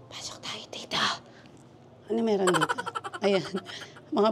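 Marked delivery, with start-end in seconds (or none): 0.1-1.0 hushed, voiceless whisper. Real, not acted. Vlogger inviting viewers to step inside, whispering. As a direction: whisper it very softly to the camera. Quietly stepping inside while filming.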